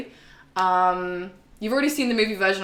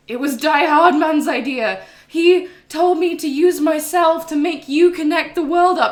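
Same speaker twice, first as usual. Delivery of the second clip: higher and louder mock explanation; imitating Fragile; 8 semitones higher, 8 dB louder than usual. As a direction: blurt it out in a hammed-up higher voice. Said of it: "And she's like".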